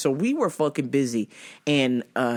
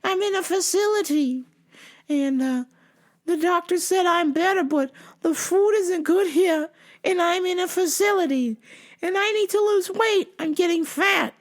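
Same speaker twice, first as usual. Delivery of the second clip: confused customer; 13 semitones higher than usual. Retold or imitated. Caller in a facility asking questions, confused customer voice. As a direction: keep it high, repeating as a confused caller.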